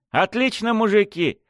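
high, breezy cocky reply; smirking. A narrator's reading. Revived victim answering his killers, smirking and upbeat. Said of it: "с ухмылкой ответил я".

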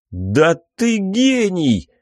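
high, delighted outburst; excited exclamation. Acted. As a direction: blurt it out in delight. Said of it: "вырвалось у меня".

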